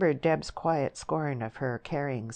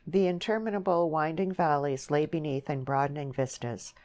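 ordinary delivery, nothing marked

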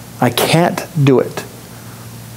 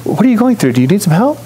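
much higher, gentle caring voice; caring offer; 6 semitones higher, faster than usual. Pretend. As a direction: sweetly offer help to a stranger. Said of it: "to say".

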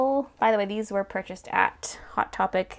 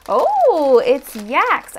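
loud, high delighted "oh"; excited reveal; 6 semitones higher, 12 dB louder, much slower than usual. Real, not acted. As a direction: burst out with delight. Reveals figure.

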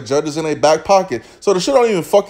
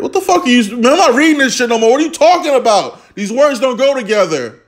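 exasperated disbelief; 6 semitones higher than usual. Real, not acted. Exasperated reading rival's statement.

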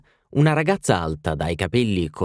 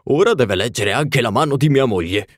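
put-on louder, jovial old man; hearty old gentleman; 4 semitones higher, 4 dB louder than usual. A narrator's reading. Sir Thomas asking the palmist to read his wife's hand, jovial and loud. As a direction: boom it out like a cheerful old gent.

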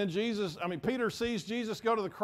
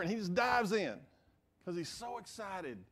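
normal talking voice

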